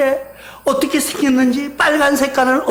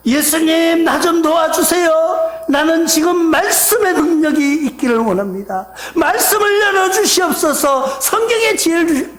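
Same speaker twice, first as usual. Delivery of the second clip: raised, sustained pleading in prayer; acted earnest prayer, pitched up and insistent; fervent prayer; 6 semitones higher than usual. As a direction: cry out for help on a high pitch. Acts out own fervent prayer.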